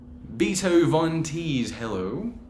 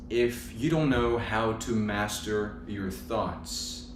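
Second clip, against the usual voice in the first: guided meditation; 5 dB quieter than usual. Real, not acted. Yoga teacher guiding viewers with eyes closed, guided meditation. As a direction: ease listeners into stillness, unhurried.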